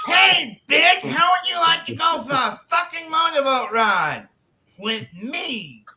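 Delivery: high yelled brash come-on. Acted. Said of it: "he goes".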